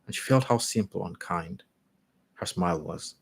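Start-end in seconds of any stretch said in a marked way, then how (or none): none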